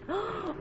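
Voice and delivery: sharp stagey gasp of horror; hammed-up gasp. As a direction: gasp like a silent-film heroine. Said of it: "gamut of emotions".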